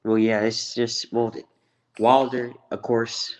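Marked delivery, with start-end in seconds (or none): none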